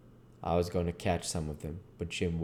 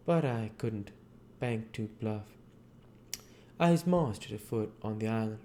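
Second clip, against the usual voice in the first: higher-pitched character voices for the dialogue; lifted voices for Huck and Jim in conversation; voicing Huck and Jim; 6 semitones higher than usual. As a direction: play the two boys talking by the river. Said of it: "we're back to the narration portion".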